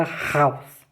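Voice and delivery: "Haus" started as "raus", with throat friction; guttural R. Shown as a demonstration. Teacher introducing the confusable word, guttural R. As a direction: scrape the start of "Haus" like a German R. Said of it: "O R sim é gutural".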